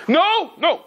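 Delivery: hammed-up sharp refusal; imagined refusal. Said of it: "He could have said".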